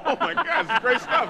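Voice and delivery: thrilled by the jokes; delighted. Retold or imitated. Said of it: "And I'm like".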